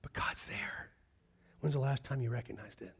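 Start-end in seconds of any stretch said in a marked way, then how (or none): 0.0-0.9 soft, whispered assurance; hushed reverence. Real, not acted. Preacher telling congregation God is there, hushed. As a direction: let it out barely voiced. Awed aside about God's presence.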